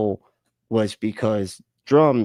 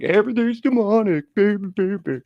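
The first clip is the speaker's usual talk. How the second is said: higher, louder, hammed-up preacher voice; mock the fired-up believers in a put-on sing-song; mocking church folk; 11 semitones higher, 3 dB louder than usual. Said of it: "They're like".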